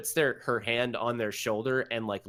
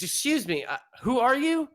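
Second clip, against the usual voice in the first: high, startled father asking who she is; surprised father; 14 semitones higher, 4 dB louder than usual. Acted. Father startled by a stranger, asking high and sharp. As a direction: jump up in pitch at the stranger.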